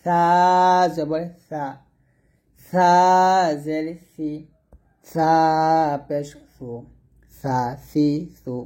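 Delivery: drawn-out thin সা, spaced সি and সু; tongue tip to upper tooth tips for সা. Shown as a demonstration. Teacher demonstrates thin pronunciation, slow spelling chant. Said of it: "সা পাতলা করে উচ্চারণ করবেন".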